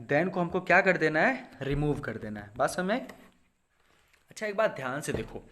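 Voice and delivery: normal talking voice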